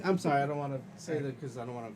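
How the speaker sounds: usual speaking voice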